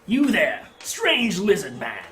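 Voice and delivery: loud, high barker calling out; carnival barker. Acted. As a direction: hail the stranger across the crowd.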